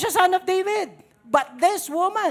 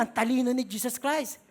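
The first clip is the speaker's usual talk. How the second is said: usual speaking voice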